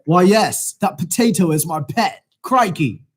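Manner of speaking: hammed-up Aussie accent; Australian accent. Shown as a demonstration. Host takes his turn at the wheel's accent, Australian accent. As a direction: throw it out in broad playful Australian.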